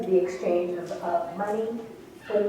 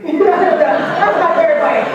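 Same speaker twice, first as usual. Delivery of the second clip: laughing; 9 semitones higher, 12 dB louder, slower than usual. Real, not acted. Laughs at own bad impression.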